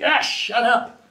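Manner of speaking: slow, scornful shut up; a fed-up snap; annoyed. Retold or imitated. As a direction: dismiss them with disgust. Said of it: "It's just like".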